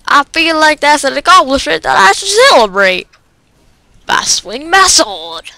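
ordinary talk, nothing marked

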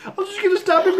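rueful high-pitched echo of himself; high mock self-quote. Real, not acted. Repeats his plan after being stabbed.

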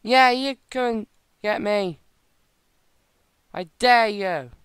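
loud, slow taunt. Real, not acted. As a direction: dare them to come. Taunting attacking pirates.

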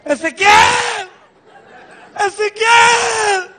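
very high, slow wailing shout of his name; high crying shout for Ezequiel; desperate shout. Retold or imitated. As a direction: call him across the street, wailing. Shouting for ex who left.

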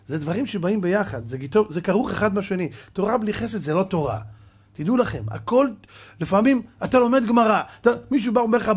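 ordinary talk, nothing marked

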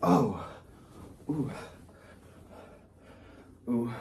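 ordinary talk, nothing marked

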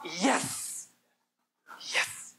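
hushed, clenched little victory yes; teen's quiet yes. Retold or imitated. Preacher imitating a teenager whose plan worked, hushed triumphant yes. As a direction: breathe it out like a fist pump. Voicing a teenager celebrating success.

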